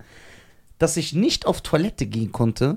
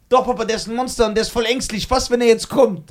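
put-on much higher, urgent kid voice; insist, scared, to dad; child swearing to dad the monster is here; frightened child; 8 semitones higher, 4 dB louder than usual.

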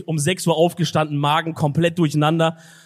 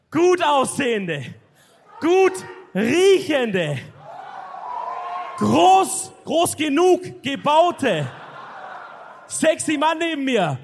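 slow, drawn-out show announcer; hype announcer; 7 semitones higher, slower than usual. Acted. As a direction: build up the big name like a ring announcer. Hammed-up stage introduction. (wie ein Ringsprecher ansagen, langsam und gedehnt)

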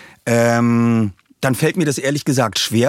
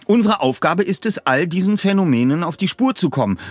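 put-on higher, eager boy's voice; young Justus explaining; 6 semitones higher than usual. A narrator's reading. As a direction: stammer at first, then explain proudly.

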